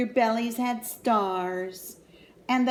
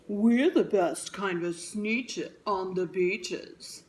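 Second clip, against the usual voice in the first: bragging; 3 semitones lower than usual. Acted. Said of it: "would brag".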